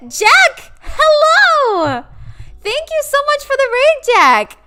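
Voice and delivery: high, loud streamer welcome; excited greeting. Real, not acted. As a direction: greet him with big energy.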